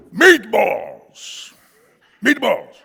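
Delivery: high, booming mock shout; frustrated loud American. American to baffled waiter, loud insistent shout. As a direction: bellow it to get understood. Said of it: "what the American's saying".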